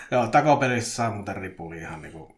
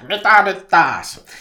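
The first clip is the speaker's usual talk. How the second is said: very loud, slow frustrated yell; turhautunut huuto; 7 semitones higher, 12 dB louder, much slower than usual. A narrator's reading. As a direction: bellow it in drawn-out exasperation. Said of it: "Petri huusi turhautuneena". (kova, venytetty turhautunut huuto)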